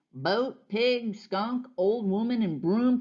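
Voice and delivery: ordinary delivery, nothing marked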